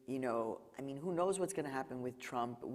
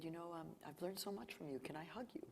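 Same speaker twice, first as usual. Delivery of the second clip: much softer, fading into a whisper; soft retold plea; 3 semitones higher, 10 dB quieter than usual. Driver asks the journalist for a hug, hushed. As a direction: ask gently, almost whispering.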